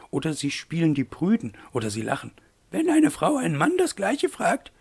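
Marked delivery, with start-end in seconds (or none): 2.7-4.7 high-pitched lady's protest; protesting mademoiselle. A narrator's reading. Aglaé protests against Wolf's argument, high indignant lady voice. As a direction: object in a high put-on lady's voice. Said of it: "protestierte Aglaé".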